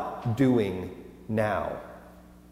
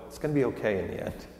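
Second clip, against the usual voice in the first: fast, softer hushed comfort; soft reassurance; 8 dB quieter, much faster than usual. Retold or imitated. Father comforting his daughter during a movie, softer and quick. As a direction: murmur it quickly into a child's ear. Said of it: "I have to whisper into, into my daughter's ear".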